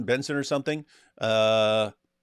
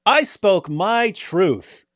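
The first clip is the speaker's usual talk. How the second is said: slow, higher and louder smug proclamation; smug child; 7 semitones higher, 5 dB louder, much slower than usual. Retold or imitated. Mocking the TV boy's line, slow, high and self-satisfied. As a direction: spell it out like a proud little preacher. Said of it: "And he goes".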